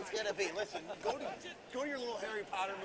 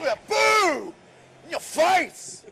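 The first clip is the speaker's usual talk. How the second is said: loud slow taunting yell; gloating shout; 8 semitones higher, 15 dB louder, much slower than usual. Acted. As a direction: taunt like a wrestling heel. Heel gloating at opponent.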